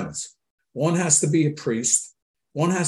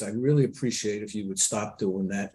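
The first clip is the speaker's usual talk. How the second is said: soft, lower, measured reproof; calm voice; 6 semitones lower, 6 dB quieter than usual. Retold or imitated. Father correcting his son, calm voice. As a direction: quietly reason with a child, low and controlled. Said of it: "always in a calm voice".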